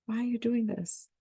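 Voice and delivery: high, softened mock scream; screaming stomach, mock version. Teacher voicing her stomach's complaint to herself, softly mock-screamed. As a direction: voice a protesting stomach in a high, small voice. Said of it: "my stomach is screaming at me".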